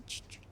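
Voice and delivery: sharp, breathy shushing sounds. Retold or imitated. Mother shushing her noisy children from bed, sharp shushing. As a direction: hush the children. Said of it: "y les hacía así".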